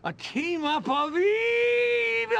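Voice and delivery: very high, loud, stretched shriek; gleeful villain cry. Acted. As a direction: shriek it out with evil relish. Villain proposes evil team-up.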